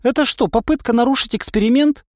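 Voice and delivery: put-on high, accusing girl's question; indignant girl. A narrator's reading. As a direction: challenge him angrily. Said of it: "гневно воззрилась на меня". (гневный высокий вопрос)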